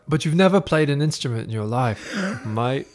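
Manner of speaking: Australian Will. Acted. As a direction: tease a bandmate in a put-on Australian accent. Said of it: "My Australian, uh, Will, basically".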